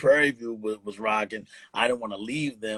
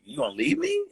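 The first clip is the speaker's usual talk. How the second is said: shocked disbelief. Retold or imitated. Shocked at partner leaving.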